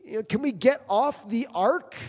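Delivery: a hint of a weary Noah begging; pleading Noah. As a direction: beg God to let you off the boat. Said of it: "and you're going".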